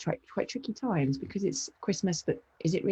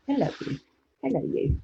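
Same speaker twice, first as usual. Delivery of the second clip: high-pitched hello to a pet; high pet-talk greeting; greeting the dog; 3 semitones higher than usual. Real, not acted.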